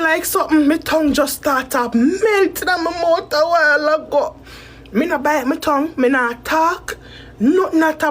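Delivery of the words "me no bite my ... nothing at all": normal talking voice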